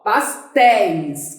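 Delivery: "pastéis" drawn out slowly on its final syllable; stress on last syllable. Shown as a demonstration. Teacher asking which syllable is strongest, "pastéis" with slow final stress. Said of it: "Qual é a sílaba mais forte?".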